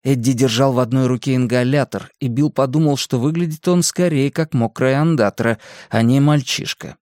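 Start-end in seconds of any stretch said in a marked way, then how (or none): none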